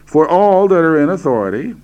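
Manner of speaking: "all" punched high and strong; stress on all. Shown as a demonstration. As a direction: hit the word "all" hard. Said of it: "I'm emphasizing the word all here".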